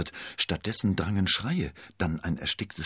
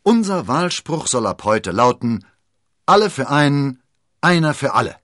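loud, high rallying cry; rousing, ringing toast; enthusiastic; 9 semitones higher, 13 dB louder than usual. A narrator's reading. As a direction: proclaim the motto with fervour. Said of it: "rief D'Artagnan begeistert".

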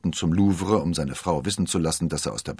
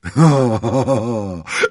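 loud, higher gleeful laugh; hearty laugh; 5 semitones higher, 11 dB louder than usual. A narrator's reading. D'Artagnan lacht über den Streich am Kardinal. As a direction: burst out laughing with delight. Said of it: "kicherte D'Artagnan".

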